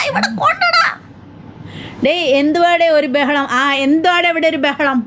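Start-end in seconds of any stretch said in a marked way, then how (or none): 0.1-0.8 laughing squeal. Real, not acted. Laughing reply to a viewer.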